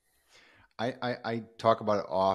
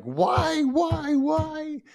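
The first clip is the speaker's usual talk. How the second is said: very high-pitched, drawn-out cry of "why"; anguished mock wail; much slower than usual. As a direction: wail it up high in hammed-up despair. Acts out angry letter to God.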